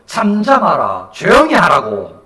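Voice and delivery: loud rebuke from those in front; rebuking. Acted. Those walking ahead telling the beggar to hush, rebuking. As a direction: scold him into silence. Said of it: "그를 꾸짖어".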